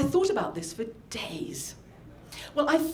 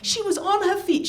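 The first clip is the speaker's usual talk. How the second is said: usual speaking voice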